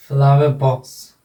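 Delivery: फ्लावर box with no R, ending "flawe"; silent final R. Shown as a demonstration. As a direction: drop the R of फ्लावर before box. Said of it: "रे का उच्चारण नहीं होगा".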